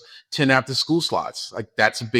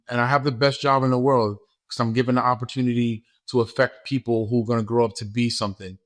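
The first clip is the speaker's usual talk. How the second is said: normal talking voice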